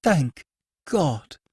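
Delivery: high, fervent relief. Acted. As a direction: breathe out thanks with fervour. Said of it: "with a transport of fervour".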